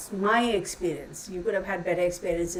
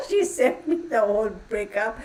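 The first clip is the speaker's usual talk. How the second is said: ordinary talk, nothing marked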